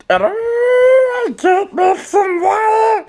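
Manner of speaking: very high, slow, shouted cartoon squeak; squeaky creature voice. Voicing a funny photo, a very high, slow shouted squeak. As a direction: shriek it out like a tiny critter. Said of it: "try and make voices for stuff".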